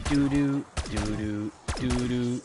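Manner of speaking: evenly spaced, monotone doo-doos; slowed-down tempo demo. Shown as a demonstration. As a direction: sound out the rhythm slowly, pair by pair. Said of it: "I'll slow it down for you".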